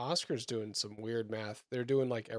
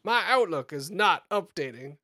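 louder, higher genteel Southern put-on; mock Southern coworker; 4 semitones higher, 6 dB louder than usual. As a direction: complain in a theatrical Southern accent. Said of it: "they're like".

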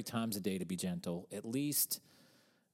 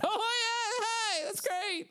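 very high, louder mock-happy yell; 4 dB louder, much slower than usual. Shown as a demonstration. Co-host showing that his yelling is happy, high gleeful yell. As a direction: yell cheerfully at the top of your range, laughing. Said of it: "I'm yelling happy things".

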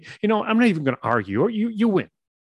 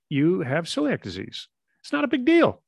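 upbeat doctor; 8 semitones higher than usual. Retold or imitated. Doctor on the phone telling Steve he'll live, breezy and upbeat. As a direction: play the cheery doctor reassuring him. Doctor gives diagnosis cheerfully.

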